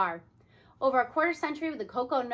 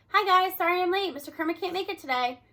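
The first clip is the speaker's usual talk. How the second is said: higher, louder, rushed and breathless; out of breath arrival; 6 semitones higher, 5 dB louder than usual. Acted. Late arrival bursting in to the class, breathless and excited. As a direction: burst in apologizing. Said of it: "Jake bursts into room".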